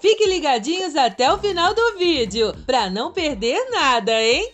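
put-on high, chirpy kids' channel greeting; sing-song cheerful hostess voice; bright squeaky sing-song welcome. Kids channel cheery intro.

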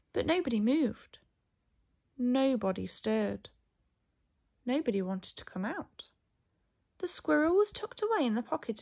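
lively picture-book storytelling; reading to children. A narrator's reading. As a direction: tell the story to small children, animated.

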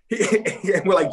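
usual speaking voice

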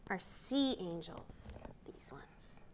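soft unvoiced whisper. Real, not acted. Teacher showing the end pages, whispering. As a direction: whisper it like a little secret.

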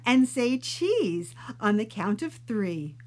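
slow, cheery sing-song; sing-song teacher. Acted. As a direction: coax big smiles slowly. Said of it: "sang out Missus O".

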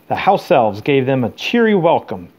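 ordinary delivery, nothing marked

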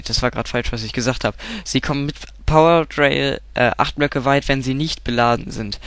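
ordinary talk, nothing marked

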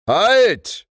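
loud, high shout to stop; thunderous voice. Acted. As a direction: thunder out the halt command. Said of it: "rief da eine Donnerstimme".

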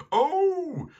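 very high, loud drawn-out "ow"; high howl of pain; dog's yelp. A narrator's reading. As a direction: yelp like a startled talking dog. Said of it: "sagte der Hund".

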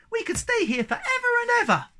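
very high squeal, growing loud; bursting out. A narrator's reading. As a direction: squeal with overjoyed excitement. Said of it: "burst out Sniff".

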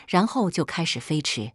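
plain reading voice

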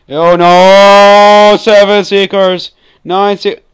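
high, loud cry of alarm; panicked shout. Real, not acted. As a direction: yell out in alarm as the threat grows. Panicking as seekers multiply in game.

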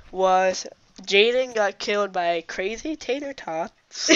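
slow, drawn-out deadpan report; slow announcement. Real, not acted. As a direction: spell it out slowly, word by word. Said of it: "That's just so funny how you said it".

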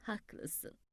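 put-on soft, breathy reply to a sleepy child; whispered agreement. Grandmother whispers agreement to the drowsy child. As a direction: hush it so he can sleep.

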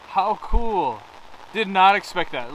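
delighted laugh into words. Real, not acted. Happy surprise.

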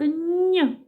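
"н" held as a palatalised nasal; isolated sound. Shown as a demonstration. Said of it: "М'який приголосний звук".

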